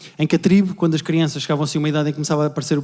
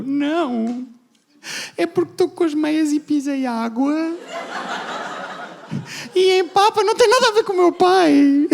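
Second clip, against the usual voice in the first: higher, slow strained voice; denying he's crying; 10 semitones higher, slower than usual. Retold or imitated. Son hiding tears from people, higher and strained. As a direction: choke out a flimsy excuse. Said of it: "E eu".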